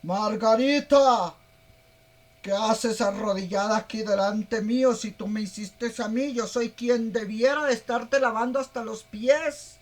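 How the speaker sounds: a statue scolding its maker gently; talking idol. Pretend. The idol speaks to Margarita kneeling before it, loud. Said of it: "Y te dijera".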